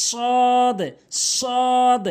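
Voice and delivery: "সদ" with a long held vowel and released d; correct pronunciation. Shown as a demonstration. Teacher models the Arabic letter, correct long "সদ". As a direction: draw out "সদ" firmly, full heavy s. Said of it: "সঠিক উচ্চারণ হচ্ছে".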